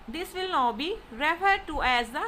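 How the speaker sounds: ordinary talk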